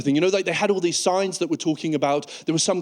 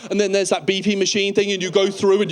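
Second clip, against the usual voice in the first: ordinary talk, nothing marked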